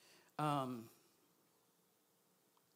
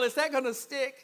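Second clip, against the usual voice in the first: usual speaking voice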